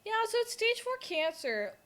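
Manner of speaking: high, put-on mom voice; imitating mom. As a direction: deliver bad news in mom's high singsong. Voices mom giving the diagnosis.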